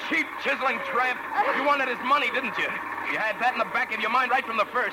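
high, contemptuous tirade; vengeful son denouncing the dancer, enraged; bitter accusation. Acted. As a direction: spit the accusation at her. Furious accusation on the ride.

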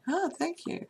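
high-pitched retold thanks; pleased acceptance. Man accepting dad's dinner invitation, pleased. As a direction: lift the pitch right up in thanks.

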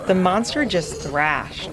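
ordinary delivery, nothing marked

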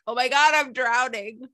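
high-pitched acted alarm; hammed-up drowning panic; mock panic. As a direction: play someone going under, voice jumping up. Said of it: "even though it feels like".

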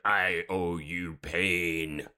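slow, drawn-out mock menace; quoted threat. Willow threatening Glory, slow mock menace. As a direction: stretch each word into a dramatic threat. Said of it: "She's like".

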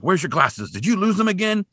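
lightly acted dad's scolding. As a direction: imitate an exasperated parent, pitch climbing. Dad voiced scolding son.

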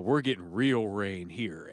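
low, slow and choppy, every word its own beat; staccato. Retold or imitated. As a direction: chop "we're getting real rain here" into separate slow, deep beats. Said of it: "it has a staccato".

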